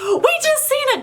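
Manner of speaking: high, breathless retold panic; flustered blurt. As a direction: blurt it out, too flustered to finish. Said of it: "And we're like".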